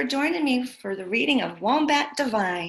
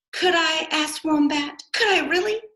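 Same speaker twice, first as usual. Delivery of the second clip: hopeful excitement; 6 semitones higher, 6 dB louder than usual. Acted. Wombat thrilled at offer.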